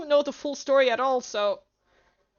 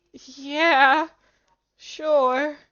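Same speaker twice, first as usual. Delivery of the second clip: usual speaking voice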